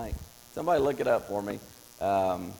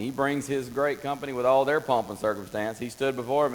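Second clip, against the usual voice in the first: normal talking voice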